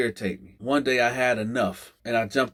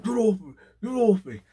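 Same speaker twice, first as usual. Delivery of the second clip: put-on panicked old man; 9 semitones higher than usual. Old man pinned under hand.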